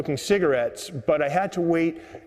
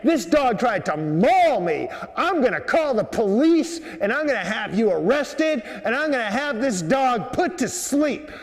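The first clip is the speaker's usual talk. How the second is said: high, indignant mock tirade; imitated screaming woman; 7 semitones higher than usual. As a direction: act out an outraged woman screeching threats. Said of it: "this lady that's screaming".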